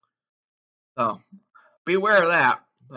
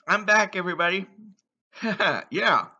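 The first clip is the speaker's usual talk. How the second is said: normal talking voice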